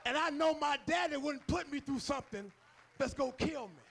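rising sermon call; full-force preaching. Acted. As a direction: lift the church with each line.

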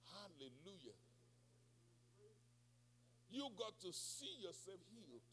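ordinary talk

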